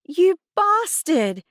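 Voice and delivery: high, loud, drawn-out outrage; outraged gasp. A narrator's reading. Girl realising Adnan read her diary, outraged gasp. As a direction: cry out in shocked fury. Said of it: "I gasped".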